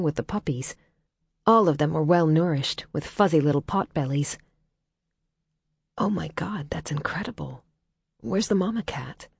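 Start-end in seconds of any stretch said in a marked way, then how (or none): 6.0-9.3 awed whisper. A narrator's reading. Awed, hushed near sleeping puppies.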